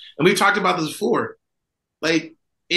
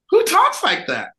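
incredulous. Real, not acted. Repeats the question with more force.

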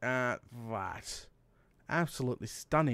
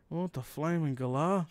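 normal talking voice

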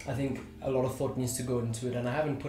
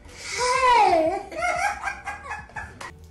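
high squealing laugh; 4 dB louder than usual. Real, not acted. Loud laugh.

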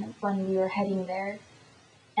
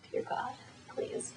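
very soft, breath-only mini prayer; whispered plea; 3 semitones higher, 13 dB quieter than usual. Real, not acted. Hoping the video works, whispered little prayer.

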